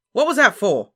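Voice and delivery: high, outraged bellow. A narrator's reading. Harry demands why he was hit, bellowing. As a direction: bellow it at full volume. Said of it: "Harry bellowed".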